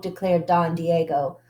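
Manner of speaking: ordinary delivery, nothing marked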